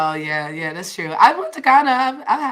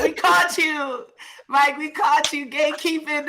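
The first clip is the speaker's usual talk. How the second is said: laughing accusation; 6 semitones higher than usual. Real, not acted. Teasing friend, laughing.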